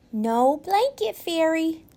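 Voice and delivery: put-on high, small child's voice; a young boy saying it firmly; little boy voice. A narrator's reading. Owen tells his parents, in a high little boy voice.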